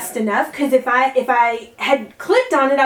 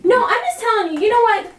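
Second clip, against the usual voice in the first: heated; 9 semitones higher than usual. Real, not acted. Heated rant about tickets.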